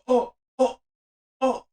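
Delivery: high, stunned little cries of "oh"; mock shock. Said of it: "I'm being so shocked".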